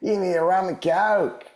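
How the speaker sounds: barfly ordering a drink in a cartoonish Australian accent; hack Australian accent. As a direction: order a rum and coke in an exaggerated Aussie accent. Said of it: "the hack Australian accent".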